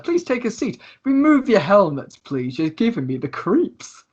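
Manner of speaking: fussy thief playing host; showy tiefling host. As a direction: invite grandly, then draw out the complaint. GM voices Lucius hosting.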